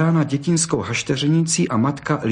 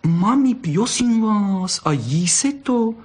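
high, drawn-out wheedling; cuddly cub pleading; coaxing child's plea; 10 semitones higher, slower than usual. A narrator's reading. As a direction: beg mum sweetly in a high little voice. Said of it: "zaškemrala mazlivě bystrouška".

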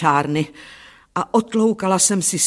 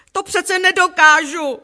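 high, loud protesting wail; shriek; 12 semitones higher, 6 dB louder than usual. A narrator's reading. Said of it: "zaječela jsem".

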